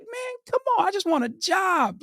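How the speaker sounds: very high whining come on; whining plea. Retold or imitated. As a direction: beg in a high whine. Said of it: "I'm like".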